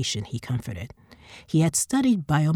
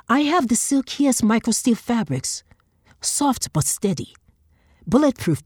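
pitched-up, pushy hawker voices; eager market traders; 4 semitones higher, 3 dB louder than usual. Acted. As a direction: sell it hard to a passing customer. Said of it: "calling her to their wares".

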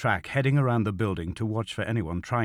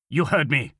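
sharp, louder snap; snapping; 6 semitones higher, 4 dB louder than usual. Acted. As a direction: cut him off. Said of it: "Kroll snapped".

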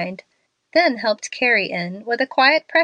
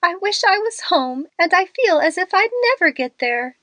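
high, tearful pleading; a frightened girl, lightly sobbing as she begs; 6 semitones higher than usual. A narrator's reading. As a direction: near tears, voice pitched up and wavering with fright. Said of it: "sobbed Lottie".

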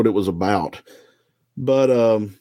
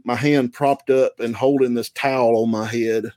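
ordinary talk

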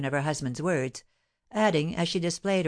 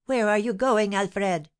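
higher, mildly screamed question; a raised, urgent call, short of screaming; 5 semitones higher, 3 dB louder, slower than usual. A narrator's reading. Wife calling after husband rushing to the door, mild scream. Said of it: "screamed the wife".

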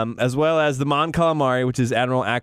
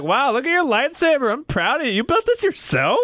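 much higher, cheesy praising voice; goofy proud dad; 11 semitones higher than usual. Pretend. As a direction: gush like a corny proud father.

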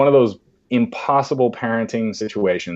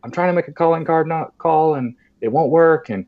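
put-on nervous kid asking for help; 7 semitones higher than usual. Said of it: "I'm like".

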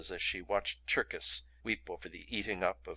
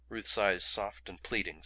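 ordinary delivery, nothing marked

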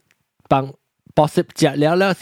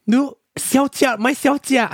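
high-pitched, drawn-out mock mother; mimicked motherly voice, pitched way up; imitating mother; 9 semitones higher than usual. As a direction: do mum's slow, high teasing call. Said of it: "我妈就要讲".

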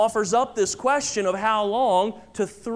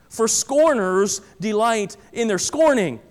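usual speaking voice

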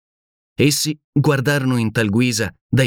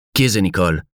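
ordinary delivery

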